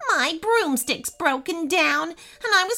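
high-pitched witch in a pickle. Acted. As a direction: explain the mishap in a high, animated witch voice. Witch explains her plight.

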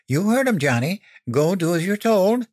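high, insistent mother giving orders; scolding order. A narrator's reading. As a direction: order a child to obey. Mother orders reluctant son downstairs.

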